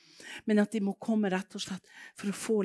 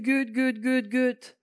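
higher, louder cry of Gud, Gud; loud, raised repeated Gud, Gud; calling out to God; 5 semitones higher, 5 dB louder, slower than usual. Retold or imitated. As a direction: call out to God in desperate disbelief. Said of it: "Jeg bare ropte".

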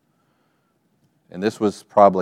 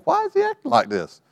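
put-on high, small voice of a confused kid in the back seat; puzzled child voice. Child in back seat puzzling over dad, high voice. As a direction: play the little kid wondering aloud, pitched way up.

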